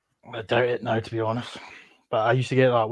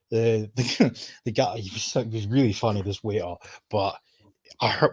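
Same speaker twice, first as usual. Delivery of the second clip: ordinary talk, nothing marked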